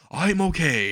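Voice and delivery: lifted, celebratory call; triumphant. Retold or imitated. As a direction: announce it in mock triumph. Triumphant recovery, hands raised.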